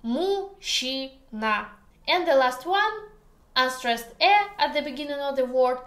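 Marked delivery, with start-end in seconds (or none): 0.0-1.7 "Мужчина" slowly split as му-щи-на; syllable-by-syllable demo. Shown as a demonstration. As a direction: sound out "Мужчина" in three parts, жч as a long soft щ. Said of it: "makes sounds Щ".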